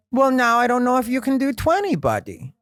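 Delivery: put-on high, accented, teasing voice; Jamie's accent. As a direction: needle the young comic like a patient uncle. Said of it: "'cause Jamie has that accent".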